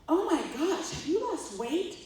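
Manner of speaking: high, loud mock gushing; gushing colleague. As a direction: exclaim like an astonished, nosy colleague, drawing it out. Colleague's gushing question, acted in story.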